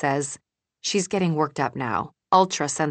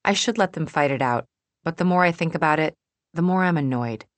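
ordinary delivery

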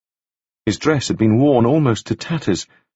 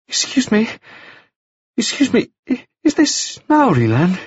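put-on much higher, bright boyish voice; high bright young gentleman; 12 semitones higher than usual. A narrator's reading. Wounded Staines greeting Taufari, high and bright. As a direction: lift it up high and bright like a dazed young toff. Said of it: "speaking in a voice that was high and very bright".

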